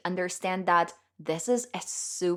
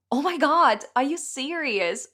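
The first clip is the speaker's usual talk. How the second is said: excited; 6 semitones higher, 5 dB louder than usual. Retold or imitated. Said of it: "And I was like".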